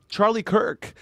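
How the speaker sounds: ordinary delivery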